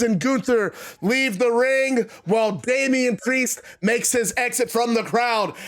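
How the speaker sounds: ordinary delivery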